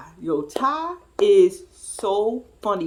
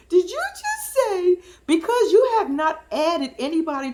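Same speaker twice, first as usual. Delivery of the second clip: mock-astonished challenge; incredulous; 7 semitones higher than usual. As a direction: stretch it out high as if stunned. Challenging friend's claim in disbelief.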